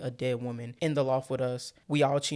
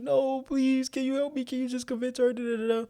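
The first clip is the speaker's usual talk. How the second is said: mock pleading; 11 semitones higher than usual. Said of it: "And he like".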